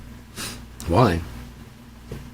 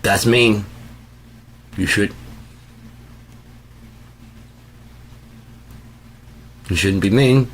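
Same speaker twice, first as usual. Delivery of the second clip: whiny; 3 semitones higher, slower than usual. Retold or imitated. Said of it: "I'm just like".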